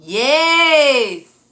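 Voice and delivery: triumphant shout; cheering yes at the answer; loud triumphant yes. Real, not acted. Exclaims at answer.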